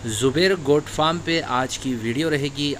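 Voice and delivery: ordinary delivery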